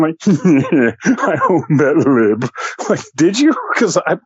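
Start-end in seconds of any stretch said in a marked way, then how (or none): 0.3-2.5 slow, gloating, laughing smug troll voice; smug troll grin. Retold or imitated. Mocking a gloating online troll, slow and smug. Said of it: "this, like, pathetic grin".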